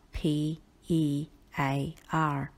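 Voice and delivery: slow letter-by-letter spelling, a pause after each; name "P E A R" one letter at a time, slowly; spelled out. Shown as a demonstration. Teacher telling students how the fruit is spelled, spelled out. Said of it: "that is spelled as".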